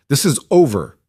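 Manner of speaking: high and loud final word; firm. Acted. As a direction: shut it down, hard on over. Samuel repeats ending fight.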